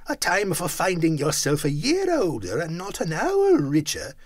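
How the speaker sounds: put-on bitter old Scrooge ranting at his nephew; grumpy old miser. A narrator's reading. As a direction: scoff at the nephew like a sour old man. Scrooge voiced as figure.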